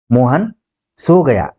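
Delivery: rising Mohan, a pause, then falling सो गया; surprise. Shown as a demonstration. Teacher showing astonishment, surprised with a pause. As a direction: break it after a questioning Mohan, then drop on गया. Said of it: "ये भी आश्चर्य हो रहा है".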